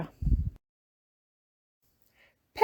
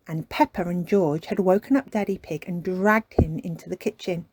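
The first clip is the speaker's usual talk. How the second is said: plain reading voice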